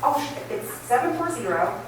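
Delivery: ordinary delivery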